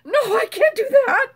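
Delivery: acted protest. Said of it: "And I went".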